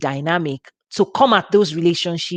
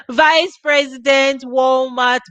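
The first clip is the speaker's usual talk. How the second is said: slow, higher, awed disbelief; amazed; 6 semitones higher, slower than usual. Real, not acted. Telling listeners she found he was a vice president, amazed. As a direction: stretch it out in wonder. Amazed at discovering his title.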